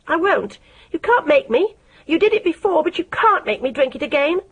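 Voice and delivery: a squeaky, frightened refusal; high and shrill. A narrator's reading. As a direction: cry it out high like a trapped mouse. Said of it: "Her words were high and shrill".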